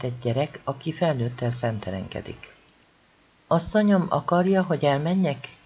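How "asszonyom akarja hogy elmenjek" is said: ordinary delivery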